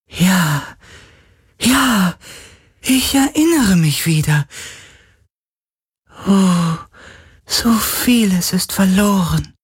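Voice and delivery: very high, airy ghostly voice; drawn-out, high lament; mournful spirit. A narrator's reading. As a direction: remember slowly as a fading otherworldly being.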